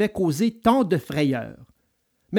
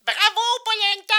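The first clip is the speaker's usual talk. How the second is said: very high little squeak of a voice; tiny voice crying out. A narrator's reading. Hidden voice in the wood taunts Geppetto, tiny high cry. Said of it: "cria la petite voix, toujours la même petite voix".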